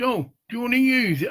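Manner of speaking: ordinary talk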